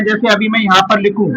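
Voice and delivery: ordinary delivery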